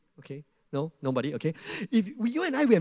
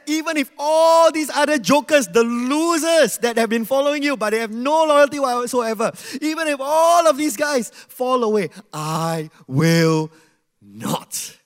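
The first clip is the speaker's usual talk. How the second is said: loud, high, scornful boast; boastful declaration; 7 semitones higher, 9 dB louder than usual. Retold or imitated. Peter boasting to Jesus about the other disciples, loud and proud. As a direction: trumpet your loyalty over the others. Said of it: "Peter declared".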